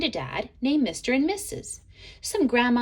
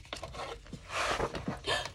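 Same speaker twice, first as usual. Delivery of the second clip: quiet gasp; 11 dB quieter than usual. Acted. Storyteller reacts to eraser.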